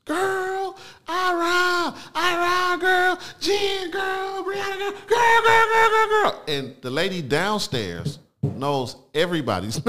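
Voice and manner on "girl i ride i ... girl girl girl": very high, shrill-pitched phone screaming; screaming neighbor on phone. Retold or imitated. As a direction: call out to friends at the top of your voice. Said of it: "And she screams".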